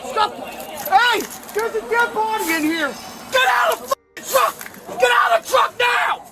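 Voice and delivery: frantic shouted orders; shouting commands. Real, not acted. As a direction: yell in alarm. Officers yell, find body.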